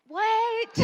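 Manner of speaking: high, loud yelp of disbelief; mock-stunned outburst. Speaker reacting to student's absurd request, mock-stunned outburst. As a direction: blurt it out in exaggerated shock.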